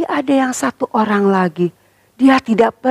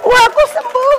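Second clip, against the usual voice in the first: joyful shout; slower than usual. Acted. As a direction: shout the miracle of healing. Healed lepers rejoice. (sorakan gembira yang tinggi)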